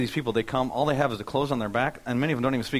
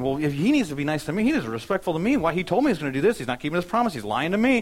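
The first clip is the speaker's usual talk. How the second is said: higher-pitched mock grievance; indignant complaint; 5 semitones higher, 4 dB louder than usual. Pastor voicing his self-centred thoughts about his friend, mock indignant. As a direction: replay an aggrieved inner rant, pitch raised.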